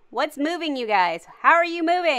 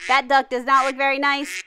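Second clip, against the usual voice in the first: ordinary talk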